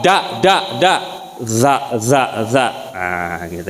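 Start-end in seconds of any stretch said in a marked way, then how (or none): 0.0-0.9 "da" dengan lidah di dalam; pengucapan da. Shown as a demonstration. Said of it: "Nah, kalau da". 1.4-2.7 "za" dengan getaran, lidah ke ujung gigi; pengucapan za. Shown as a demonstration. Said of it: "za ada getarannya".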